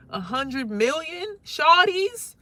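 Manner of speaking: stunned rising question; incredulous. Pretend. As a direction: drag it out in shock. Incredulous repeat.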